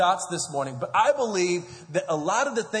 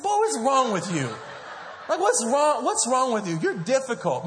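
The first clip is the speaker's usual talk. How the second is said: laughing parent scold; 6 semitones higher than usual. Retold or imitated. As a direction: scold a toddler while half laughing. Parent scolding toddler son.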